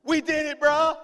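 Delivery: high, loud celebration; pumped-up hollering; unrestrained excitement. Acted. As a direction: cheer like you just won with your bro. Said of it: "A, do what I wanna do".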